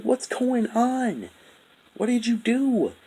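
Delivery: very high, slow mock shock. Imitating shocked Dopey asking what happened, very high and slow. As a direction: squeak it out, stunned. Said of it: "he was shocked".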